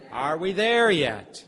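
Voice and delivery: put-on loud, drawn-out kid's question; long, loud backseat nagging; whining child in car. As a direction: call it out slowly like an impatient child. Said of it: "one question that children ask over and over".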